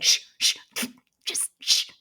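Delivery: shushing. Retold or imitated. Adults shushing a loud kid.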